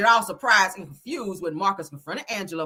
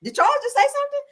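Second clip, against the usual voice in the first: much higher gasp of disbelief; mock surprise; 14 semitones higher, much faster than usual. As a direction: act shocked he spoke. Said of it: "We was like".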